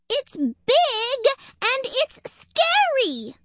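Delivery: very high, loud, drawn-out wail; escalated squirrel shriek, slow and insistent; panicked squirrel. A narrator's reading. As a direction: stretch BIG and SCARY in terror. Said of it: "out of breath and in a panic".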